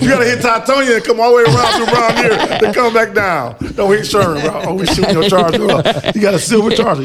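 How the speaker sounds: loud, animated mock threat; loud teasing warning. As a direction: heckle a friend over the route. Loud teasing about a dangerous route.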